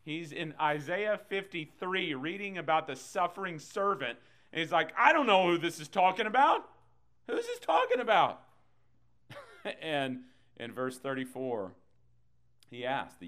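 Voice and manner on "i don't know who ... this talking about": high, loud cry of bewilderment; exaggerated mock-puzzled yell; baffled shout. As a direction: play clueless at the top of your voice. Acting out the baffled eunuch.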